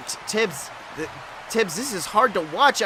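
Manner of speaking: ordinary talk, nothing marked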